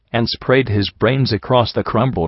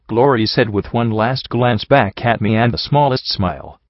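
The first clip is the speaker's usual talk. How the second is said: usual speaking voice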